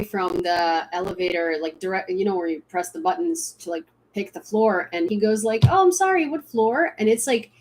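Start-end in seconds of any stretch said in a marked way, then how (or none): 5.7-6.9 imitating a posh English accent, pitched up; lightly mimicking an English celebrity's accent. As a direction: put on his polite English accent. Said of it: "really, really English accent".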